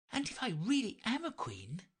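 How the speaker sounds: put-on high, light girlish voice; Alice voice. A narrator's reading. Alice to herself, dreaming of being queen, in a high girlish voice. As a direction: read Alice eager and light.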